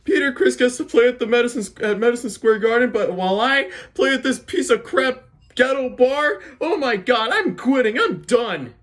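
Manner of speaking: high-pitched mock whine, hammed-up; whining. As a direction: whine like a put-upon musician, way up high. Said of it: "you're just whining because".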